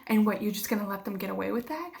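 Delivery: ordinary delivery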